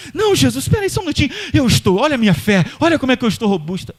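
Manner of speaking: a hammed-up boast of being ready for anything; boastful bragging. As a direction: show off your faith to everyone. Woman ignores Jesus, keeps boasting.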